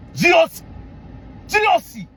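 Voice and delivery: shouted mockery of a general; scornful shout. Real, not acted. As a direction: fling his title back at him.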